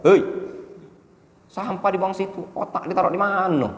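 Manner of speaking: mock scolding in Indonesian. Shown as a demonstration. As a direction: scold like a fed-up teacher. (teguran tinggi dan keras)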